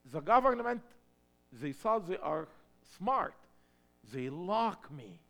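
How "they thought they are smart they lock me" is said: normal talking voice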